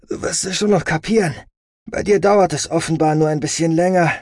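high, emphatic brother's retort; impatient brother, condescending. Acted. Plays brother teasing his sister.

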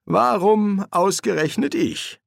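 high, slow lament; drawn-out, high-pitched wail of protest; plaintive complaint. A narrator's reading. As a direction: whine it slowly, why me. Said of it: "klagte Crowley".

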